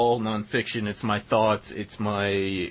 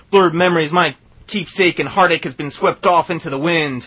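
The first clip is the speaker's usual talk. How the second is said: louder, higher menacing recitation; growing outlaw swagger, louder; cowboy poetry; 9 semitones higher, 13 dB louder than usual. Acted. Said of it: "This is called Psycho Killer Cowboy Poem".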